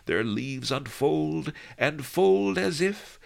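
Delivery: put-on high, loud, slow old singer; crazy old voice drifting on the wind; old cracked crazy voice. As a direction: quaver it out like a mad old poet. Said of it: "old, cracked, crazy voice".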